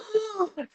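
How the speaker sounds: high sudden inhale; demonstrated gasp of coming back to life; sharp gasp. Shown as a demonstration. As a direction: gasp for air as if reviving. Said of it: "and I would gasp".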